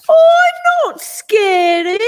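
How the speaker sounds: high, loud protest; indignant shout. A narrator's reading. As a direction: shout it back, offended. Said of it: "shouted the polar bear".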